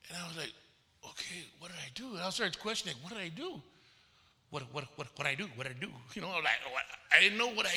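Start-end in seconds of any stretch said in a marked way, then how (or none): 1.0-5.9 hushed, much softer self-questioning; retold bewilderment. Minister questioning himself after pastor's rebuke, quietly bewildered. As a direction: ask yourself softly, as if stunned.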